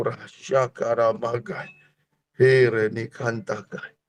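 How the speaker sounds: a low, soft, slow run of tongues; quiet glossolalia under the sermon; speaking in tongues. Acted. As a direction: murmur the syllables like a prayer.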